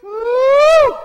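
loud, high shout of joy; excited shout. Real, not acted. Preacher whooping in praise, high and loud. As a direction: let a big woo fly.

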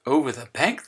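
plain reading voice

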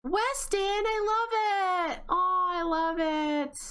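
high, stretched-out gushing; delighted squeal. Real, not acted. Player loves the child's new trait, squealing delight.

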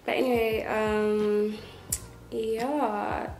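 slight lisp from brackets; careful stiff mouth movement; talking with new braces. Real, not acted. Seen 1: Metal brackets on her teeth are visible whenever she opens her mouth.

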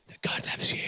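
just breathe the words; whispered secret. Pretend. Preacher whispers the secret to the volunteers.

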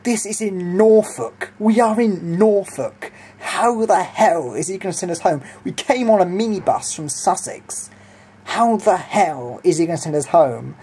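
mock-outraged, leaning on key words; retold incredulous protest.

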